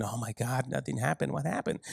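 a soft, let-down mutter; quietly deflated, mock disappointment; disappointed murmur. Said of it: "come back down the mountain".

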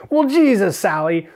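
high-pitched exasperated comeback; mock protest. Acts out protest to boss.

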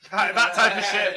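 loud laugh spilling through the words. Real, not acted. Admitting his childish habits to friends, laughing loudly. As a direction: laugh it out, amused at himself. Laughing about drinking from a bottle.

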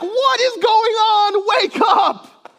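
high, loud, stretched-out yell; frantic shout. Acted. Preacher acting the disciples waking Jesus, frantic shout. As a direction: yell to rouse a sleeper in alarm. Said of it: "like, they're like".

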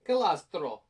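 Calastro in an Italian accent. Shown as a demonstration. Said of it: "the Italian accent".

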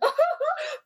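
high-pitched mock sob; exaggerated imitation of a crying man; imitated sobbing. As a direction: burst out in fake, squeaky sobs. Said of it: "And then I just hear".

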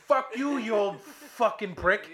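loud, high outburst, drawn out at the end; angry cursing. Retold or imitated. Golfer cursing out the old marshal, furious. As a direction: let rip at him in outrage.

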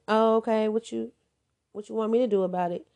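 loud, fast, careless mock reply; dismissive brush-off. Mimicking an uncaring partner, dismissive and loud. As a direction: toss it off like it doesn't matter.

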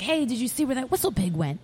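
high-pitched mock French accent. French stranger calling out to Raj in the woods, mock French accent. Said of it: "he has a French accent".

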